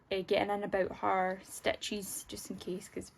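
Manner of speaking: soft unvoiced aside; whispering. Real, not acted. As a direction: whisper it under your breath. Aside about dog's stitches.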